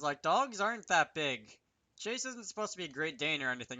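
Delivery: ordinary delivery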